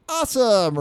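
put-on high, loud kid exclamation; excited imitation of a child. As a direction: mimic a boy shouting his tagline mid-jump. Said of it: "He's like".

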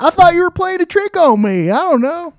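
high, loud southern coworker voice; southern accent. Retold or imitated. Coworker Dean explains himself, southern accent. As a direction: answer like a startled Southern guy. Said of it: "He's got a southern accent".

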